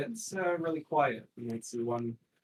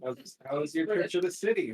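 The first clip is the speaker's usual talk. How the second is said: put-on British accent; 6 semitones higher, 6 dB louder than usual. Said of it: "And you've got the British accent".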